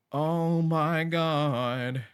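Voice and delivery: loud, high, very slow exclamation; drawn-out exclamation. Real, not acted. Player reacting to the game, loud drawn-out exclamation.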